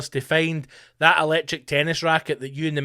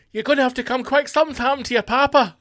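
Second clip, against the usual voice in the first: urgent caller; 8 semitones higher, 4 dB louder, faster than usual. Retold or imitated. Voiced caller with bad news.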